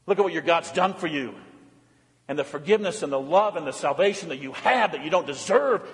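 ordinary talk, nothing marked

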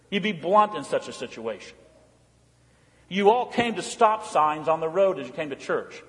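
ordinary talk

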